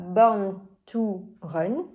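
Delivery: slow English in a French accent; careful, clumsy French-accented English; bad English accent. Real, not acted. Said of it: "Je suis désolée pour mon accent de merde".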